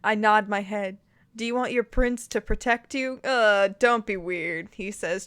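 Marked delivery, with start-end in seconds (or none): none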